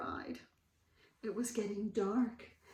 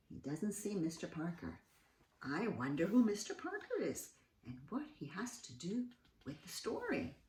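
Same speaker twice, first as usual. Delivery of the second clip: hushed aside; 3 semitones lower, 8 dB quieter, faster than usual. A narrator's reading.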